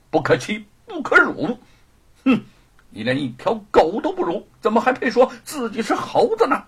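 haughty, angry denunciation; scornful tirade rising to a dismissal; indignant. Acted. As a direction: berate him with growing indignation. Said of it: "怒视着狱卒".

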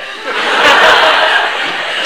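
high burst of laughter; loud laugh. Real, not acted. Storyteller laughing hard at the uncle's fingerprint trick.

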